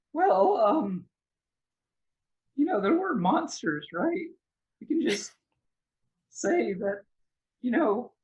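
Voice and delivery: hesitant, high-pitched character voice; Connor. Acted. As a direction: stammer a suggestion in a high put-on voice. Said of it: "looking at you like".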